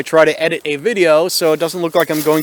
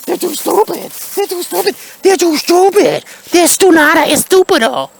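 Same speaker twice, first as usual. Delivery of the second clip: much higher, cartoonish repeating; squeaky mock voice; 12 semitones higher than usual. [name] answering about the police chief, squeaky and mocking. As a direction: chant it like a silly little creature.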